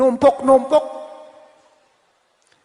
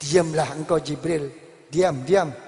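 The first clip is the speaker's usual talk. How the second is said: lower, calm commanding voice; divine command; 6 semitones lower than usual. Acted. God tells the pleading angel Jibril to be silent, low and calm. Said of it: "Apa kata Allah?".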